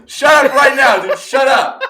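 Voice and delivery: high shrieking shout of disbelief; incredulous shout. Real, not acted. Yelling at a friend over a sandwich, outraged shout. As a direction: yell it in mock outrage at a friend.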